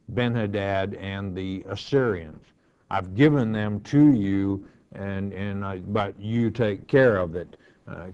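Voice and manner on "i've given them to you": usual speaking voice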